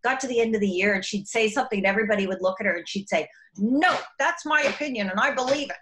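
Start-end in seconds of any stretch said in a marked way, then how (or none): 3.6-5.8 higher, sure and firm; firm conviction. Retold or imitated. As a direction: state it like you mean it. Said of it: "really firm in her belief".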